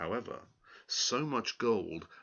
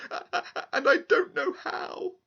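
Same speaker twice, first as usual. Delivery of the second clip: put-on high, louder, sobbing girl; tearful girl; 4 dB louder than usual. Girl tells the little man her plight, sobbing high. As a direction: blurt it out through tears.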